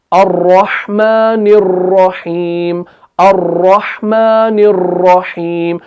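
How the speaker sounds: अर्रहमानिर्रहीम with the र dragged into many taps; slow chant, stretch each र too long; wrong way, multiple र trilled; over-rolled र recitation. Shown as a demonstration. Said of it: "ये अर्र जो हो रहा है".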